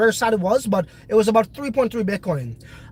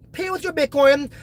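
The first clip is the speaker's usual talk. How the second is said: ordinary talk, nothing marked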